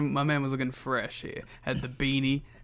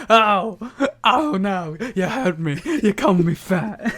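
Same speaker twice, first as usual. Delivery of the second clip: much higher, louder fake wounded whine, laughing; mock hurt Dusty; 6 semitones higher, 7 dB louder, slower than usual. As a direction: play the offended big man for laughs. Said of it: "And then Dusty's like".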